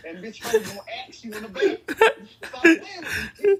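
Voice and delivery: loud, laughing playful threat; laughing mock threat. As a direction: bark it out while cracking up.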